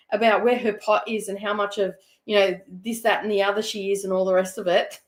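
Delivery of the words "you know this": ordinary delivery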